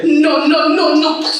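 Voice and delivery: loud, high-pitched repeated protest; panicked shout. Acted. Mother sprayed with paint, panicked shouting. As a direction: shout in rising alarm.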